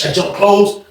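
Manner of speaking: ordinary talk, nothing marked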